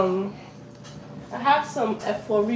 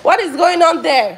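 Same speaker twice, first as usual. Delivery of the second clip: calling out; 7 semitones higher, 6 dB louder than usual. Real, not acted. Mother calling to kids offscreen.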